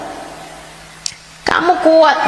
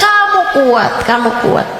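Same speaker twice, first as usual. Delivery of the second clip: ordinary talk, nothing marked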